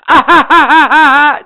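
very high, loud cackling laugh; mock laughter. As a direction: imitate callous laughter at full volume. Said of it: "and you go".

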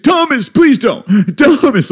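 high, loud frantic plea; shrill-pitched cry to snap him out of it; pleading mom. Retold or imitated. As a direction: imitate a panicked mother begging. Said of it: "she was trying to wake him up".

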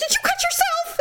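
high-pitched mock panic; alarmed retold question. Imitating Joe asking about the cut, high alarm.

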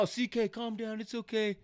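high, softened mock reassurance; calm him down in a put-on high voice; mock soothing. Friends retelling how they calmed a drunk buddy, mock soothing.

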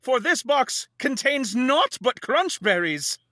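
high, slow, hammed-up medieval lament; hammy stage actor. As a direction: wail it like a ham actor on stage. Woe is me, prithee.

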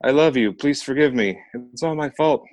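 high, loud crying farewell; tearful farewell. A narrator's reading. Girl carried away calls her last words to siblings, tearful high cry. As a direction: call back your love through tears.

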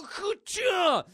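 big high shouted impact noise, slow; shouted ka-cha. Retold or imitated. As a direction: yell the hit sound. Spear impact sound effect.

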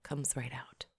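soft unvoiced whisper; hushed confession. Real, not acted. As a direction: whisper it like a secret. Hushed aside about tampon.

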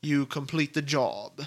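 slow, lightly put-on British accent. Gadget man briefing Mrs Bond on a poison capsule, in a light British accent. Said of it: "that was a perfect accent".

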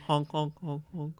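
low soft mock honking; goose honk imitation. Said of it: "It's a goose".

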